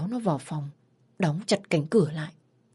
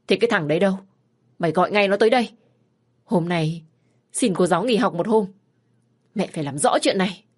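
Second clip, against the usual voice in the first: put-on a frantic mother berating her child; scolding mother; 2 semitones higher, 4 dB louder, faster than usual. Mother scolding her teenage daughter, loud and distraught.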